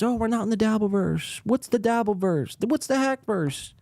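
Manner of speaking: sneering impression of a smug host; mocking Gino. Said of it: "When Gino and all these guys".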